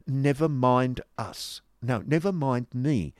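affected titter in the voice. Acted. Miss Squeers brushes off her friend, tittering. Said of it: "tittered Miss Squeers".

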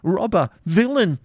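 high, loud, slow insult hurled back; furious retort. Acted. Isaac retorting the baron's insults, furious. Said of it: "retorting the insults".

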